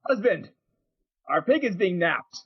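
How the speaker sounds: high mock yell, no real volume; yelling back. Wife at the door to her husband, mock yelling back. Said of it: "and she yells back".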